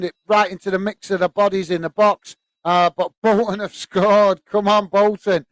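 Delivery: celebrating a Bolton goal live, slowing to savour it; excited goal call. Real, not acted. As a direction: shout it like your team just scored. Goal scored in other game.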